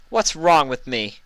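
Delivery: high pouty Luna complaint; pouting Luna. Acted. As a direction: whine it like a sulky younger sister.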